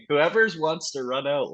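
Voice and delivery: normal talking voice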